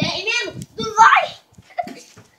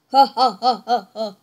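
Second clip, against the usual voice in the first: evil laugh; 4 semitones lower, 6 dB louder, slower than usual. Pretend. Mascot laughs after threat.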